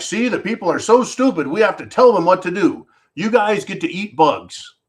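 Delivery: mock the condescending globalists; mocking elites. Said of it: "they're like".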